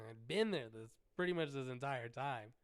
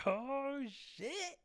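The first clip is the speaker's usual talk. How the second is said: high, loud delighted exclamation; excited outburst; 12 semitones higher, 5 dB louder than usual. Retold or imitated. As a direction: yell it thrilled. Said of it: "I got excited".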